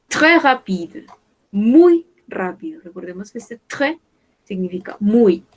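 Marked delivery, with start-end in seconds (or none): 2.8-5.4 quick aside. Real, not acted. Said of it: "Acá no pronunciamos la S".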